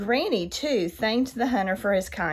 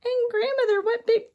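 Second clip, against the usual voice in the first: put-on high wondering girl voice; little girl voice. A narrator's reading. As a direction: innocent surprised child. Girl addresses disguised wolf.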